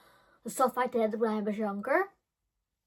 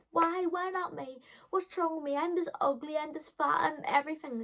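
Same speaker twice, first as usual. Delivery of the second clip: high, wailing self-pity; whiny teen; 7 semitones higher than usual. Retold or imitated. Teen complains to Paula about her looks, high and whining. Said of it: "I'm like".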